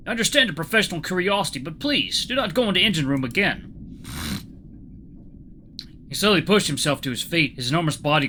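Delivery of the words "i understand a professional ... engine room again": put-on higher, pleading Russian accent. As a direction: make an earnest request in a Russian accent. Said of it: "see if I can do a Russian accent".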